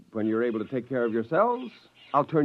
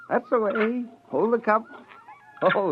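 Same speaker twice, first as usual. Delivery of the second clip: higher, gentle coaxing as to infants; coaxing baby talk; 10 semitones higher than usual. Acted. Scientist coaxing newborn Volplas to drink, gently. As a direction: coax the little ones to hold the cup.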